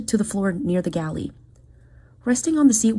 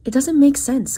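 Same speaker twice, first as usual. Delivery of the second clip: sharp frustrated snap; snapping; 7 semitones higher, 5 dB louder than usual. A narrator's reading. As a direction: bite the words out. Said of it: "Kyle snapped".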